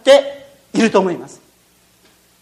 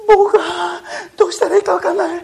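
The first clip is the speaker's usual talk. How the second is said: higher, fast, near-crying panic; panicked; 9 semitones higher, faster than usual. Acted. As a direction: babble in terror, half laughing. Boy panics inside crocodile's home. (ワニの家で泣きそうに慌てる少年)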